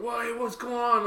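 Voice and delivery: high, drawn-out mimicked question; retold coach. Telling how coach leaned over, imitated questioning coach. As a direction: lightly act out the coach leaning over. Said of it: "so our coach, like leans over".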